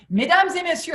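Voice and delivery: high announcer call in French; French PA announcement. Retold or imitated. Mop seller paging the store in French, high announcer call. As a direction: summon the shoppers in French over the PA. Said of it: "I do them in French and English".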